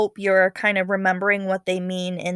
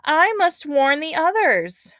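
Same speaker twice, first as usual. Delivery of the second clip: higher, louder, urgent and frightened; alarmed cry; 8 semitones higher, 5 dB louder than usual. Acted. Girl running to warn her village, urgent frightened cry. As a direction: call out the warning in alarm. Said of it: "Nannetti cried".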